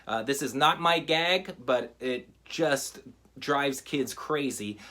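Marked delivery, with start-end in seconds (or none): none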